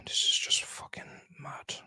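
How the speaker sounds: soft unvoiced whisper, fed up; whispered exasperation. Real, not acted. Fan despairing at the game, whispered exasperation. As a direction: breathe it out in disbelief.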